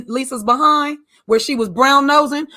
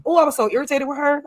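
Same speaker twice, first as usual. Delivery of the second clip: normal talking voice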